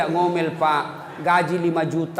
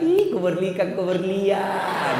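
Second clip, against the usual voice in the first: sweet cooing; much slower than usual. Pretend. Wife cooing to husband.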